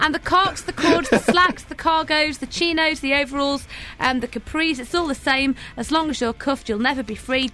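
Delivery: rousing lyric recital. Acted. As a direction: list them like a rallying speech. Recites lyrics, building to slogan.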